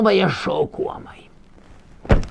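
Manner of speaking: whispered slow Hebrew phrase. Acted. Rabbi quoting scripture to students in a slow whisper. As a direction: breathe the quote out softly.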